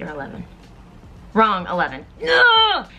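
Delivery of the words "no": high, loud cry of disbelief; a loud, high protest at the answer; shocked no. Real, not acted. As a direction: yelp it out in shock. Reacts to wrong answer.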